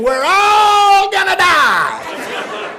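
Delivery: put-on drawn-out, high child's scream; panicked scream. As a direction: scream it long to cut through the quarrel. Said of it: "and I just screamed".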